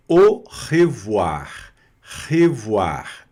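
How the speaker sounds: slow, stretched "au revoir". Shown as a demonstration. Teacher assembles the word for students, "au revoir" slowly sounded out. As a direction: draw out "au revoir", starting on a clean O. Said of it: "A mais U vira O".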